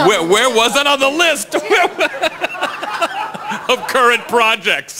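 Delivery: high, incredulous question breaking into laughter; laughing tease. Real, not acted. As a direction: tease through helpless laughter. Teasing colleague about wedding announcement.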